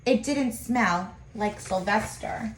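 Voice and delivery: usual speaking voice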